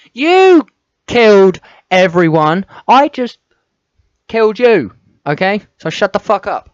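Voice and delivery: very loud, high, raging yell; furious scream. Real, not acted. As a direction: scream it in full fury. Player screaming at the character.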